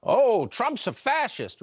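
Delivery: mocking sarcasm. Host mocking the media's old slogan, slow sarcastic sneer. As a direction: drag it out like a taunt you don't believe.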